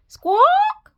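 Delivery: very high, loud squawk; hen squawk. A narrator's reading. Imitating the hen's squawk.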